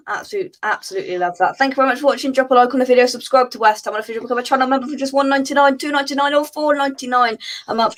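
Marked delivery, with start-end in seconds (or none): 1.6-8.0 rapid-fire higher-pitched sign-off; one breath, very quickly. Real, not acted. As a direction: rattle off the plug without stopping for air. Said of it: "say that in one breath very quickly".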